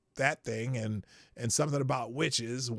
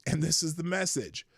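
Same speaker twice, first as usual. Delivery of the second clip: ordinary talk, nothing marked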